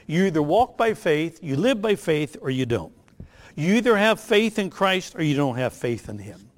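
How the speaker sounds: ordinary talk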